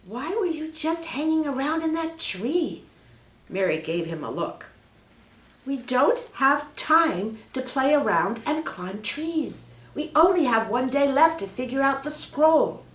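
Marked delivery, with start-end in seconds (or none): 0.1-2.8 high, incredulous question; Mary's voice. Acted. Mary questioning Peter about the tree, high and incredulous. 5.6-12.8 scolding. Acted. Mary scolding Peter for wasting time, impatient.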